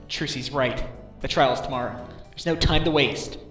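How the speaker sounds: loud, determined rallying; newly determined, urgent; fired-up resolve. Acted. Resolves to act after daughter's push.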